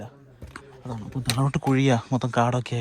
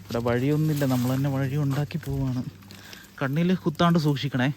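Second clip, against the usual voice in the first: normal talking voice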